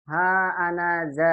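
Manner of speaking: "ha anaza" with a held long ha. Shown as a demonstration. Teacher modelling "ha anaza" slowly for students. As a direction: draw out "ha" before anaza.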